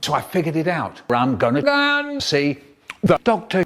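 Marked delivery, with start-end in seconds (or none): none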